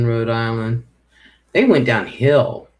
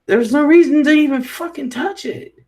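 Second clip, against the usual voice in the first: higher and a bit louder, fed up; exasperated outburst; 13 semitones higher, 4 dB louder than usual. Real, not acted. Telling viewers nobody should touch the whisk, fed up. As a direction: spit it out, completely fed up. Venting about the missing whisk.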